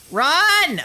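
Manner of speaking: high, urgent yell; panicked cry. Real, not acted. Player fleeing an enemy, panicked yell. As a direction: cry out in alarm, pitched high.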